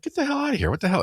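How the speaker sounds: high, sharp yell of alarm; startled yell at goose. Retold or imitated. Yelling at a goose in the parking lot, startled and high. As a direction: shoo it off in a panic, pitch shooting up. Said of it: "He starts yelling at me".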